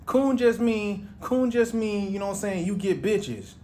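lightly voiced friend shrugging it off; mock casual explaining. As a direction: brush off the complaint. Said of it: "He's like".

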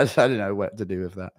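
ordinary talk, nothing marked

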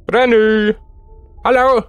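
drawn-out, high searching call. Pretend. Player hunting for Granny, calling out playfully. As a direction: call playfully through the house.

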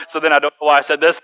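normal talking voice

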